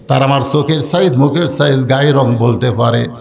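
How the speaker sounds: intoned speech starting high, stepping down; melodic waz preaching. A narrator's reading. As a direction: chant it high, then let it descend. Waz preacher chants Khadija's speech.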